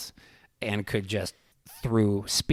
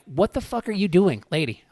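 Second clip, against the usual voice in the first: loud, high-pitched exasperation; retold indignant challenge; 9 semitones higher, 7 dB louder than usual. Dad to a screaming mom at the game, indignant. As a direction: challenge her, voice jumping up.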